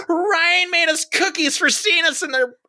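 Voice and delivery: high-pitched, hammed-up girl's voice; girl announcing loudly. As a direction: broadcast it to embarrass him.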